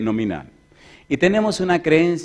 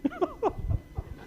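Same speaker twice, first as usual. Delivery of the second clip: high laugh; 12 dB quieter than usual. Real, not acted. Preacher bursts out laughing.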